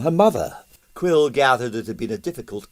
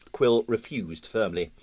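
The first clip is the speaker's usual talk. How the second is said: ordinary delivery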